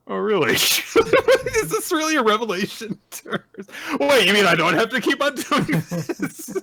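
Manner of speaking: high, squeaky mock disbelief; high mock surprise. Toshusai learning the penalty was never binding, high and giddy. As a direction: voice her like a giddy schoolgirl.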